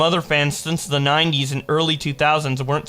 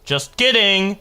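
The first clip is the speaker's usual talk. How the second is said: ordinary talk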